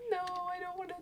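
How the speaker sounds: high, wailing mock sob of a scared kid; mock crying. Retelling crying on the bus, high mock wail. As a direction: whine it like a terrified little kid. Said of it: "I started crying on the bus".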